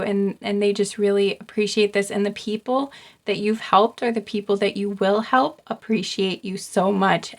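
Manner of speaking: ordinary talk, nothing marked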